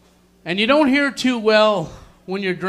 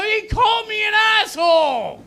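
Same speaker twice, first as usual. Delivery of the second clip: mock indignant complaint. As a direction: complain like an offended drunk. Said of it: "I go".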